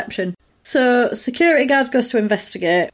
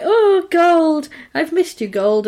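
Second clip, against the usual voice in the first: doting; 7 semitones higher, slower than usual. Retold or imitated. Man kissing his gold coins, hammed-up adoring. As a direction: coo it like greeting a lost love.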